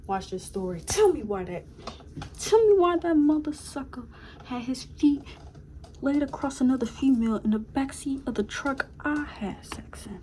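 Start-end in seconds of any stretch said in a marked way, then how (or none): 0.8-9.6 1 outraged rant. Real, not acted. Finding him with another girl.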